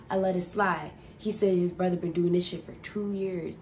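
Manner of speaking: normal talking voice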